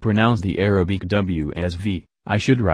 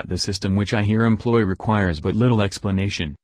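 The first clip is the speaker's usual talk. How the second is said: usual speaking voice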